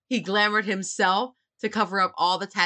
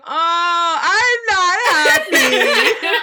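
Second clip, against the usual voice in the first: hammed-up crying wail, much higher and louder; mock sobbing; 11 semitones higher, 11 dB louder, much slower than usual. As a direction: wail it like a fake sob through laughter. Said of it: "And I was like".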